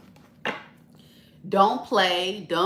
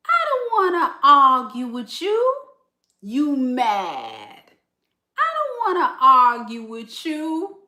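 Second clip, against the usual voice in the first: high teasing sing-song, laughing through it; mocking sing-song quote; 6 semitones higher, 4 dB louder than usual. To the viewer, mocking the Scorpio with a high laughing taunt. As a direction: mimic them in a high, taunting lilt.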